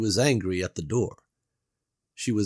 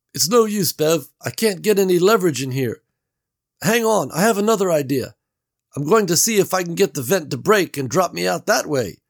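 raised, louder character voice for Gary; Gary calling through the wall; Gary's voice; 10 semitones higher, 7 dB louder than usual. A narrator's reading. Gary calls through the wall to Bev, raised voice.

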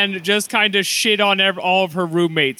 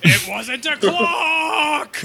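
laughing shout; 8 semitones higher, much slower than usual. Real, not acted. Laughing protest at a joke.

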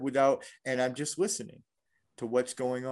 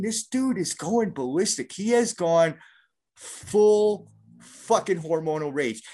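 usual speaking voice

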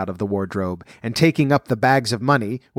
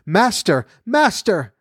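harp crying out, climbing much higher; calling out; 8 semitones higher, slower than usual. A narrator's reading. Magic harp calling its master for help, high cry. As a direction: call out in alarm, rising high. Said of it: "the harp called out".

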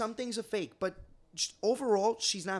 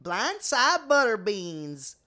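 slow, louder Southern country drawl; country accent; 2 semitones higher, 6 dB louder, much slower than usual. Pretend. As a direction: really lean into the country twang, slowly. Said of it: "Wow, that was really good".

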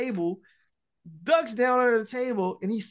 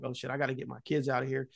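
much softer, lower and fast, thought to himself; inner thought; 7 semitones lower, 9 dB quieter, faster than usual. Retold or imitated. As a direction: rush it under the breath like a private panic. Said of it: "thinking, like".

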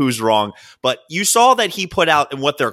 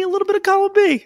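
squeaky high voice; faster than usual. Acted. Squeaky voice on second half.

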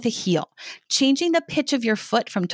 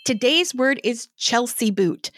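usual speaking voice

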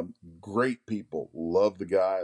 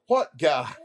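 louder, higher mock yell; yelling back; 7 semitones higher, 5 dB louder than usual. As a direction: fire it back like a bickering partner. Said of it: "they yell at each other".

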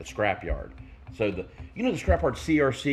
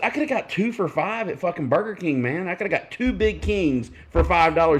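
retold angry rant; 8 semitones higher, 3 dB louder than usual. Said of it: "I was mad".

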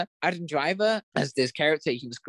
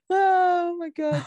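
shocked gasp; 12 semitones higher, 3 dB louder, much slower than usual. Real, not acted. Shocked recalling Leto performance.

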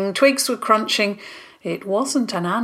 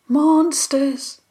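"Monsters!" called out higher into the trees; calling out; 8 semitones higher than usual. Acted. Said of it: "calling quietly".

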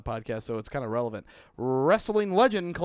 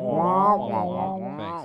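Peanuts adult voice; 3 semitones higher, much slower than usual. Retold or imitated. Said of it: "their parents would talk".

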